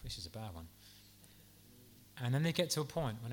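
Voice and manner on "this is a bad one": low, much softer murmur; hushed dread. Retold or imitated. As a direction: say it under your breath in fear. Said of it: "look at each other and think".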